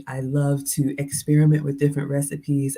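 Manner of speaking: ordinary talk, nothing marked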